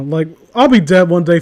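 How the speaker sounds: usual speaking voice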